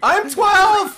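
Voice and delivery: giddy. Real, not acted. Joking after laughing fit.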